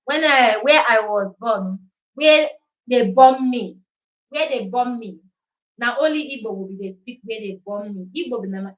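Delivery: story told in Nigerian Pidgin English. Shown as a demonstration. Speaking Pidgin English to her viewers. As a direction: switch into Pidgin for your own story. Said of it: "make I use pidgin English".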